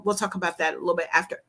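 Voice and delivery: usual speaking voice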